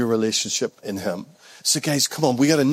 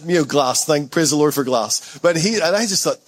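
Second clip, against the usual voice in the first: ordinary talk, nothing marked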